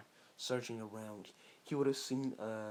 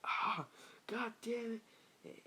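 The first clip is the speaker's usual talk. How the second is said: retold scream; 12 semitones higher, slower than usual. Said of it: "scream, saying".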